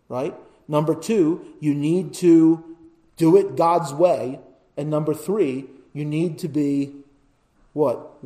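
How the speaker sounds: normal talking voice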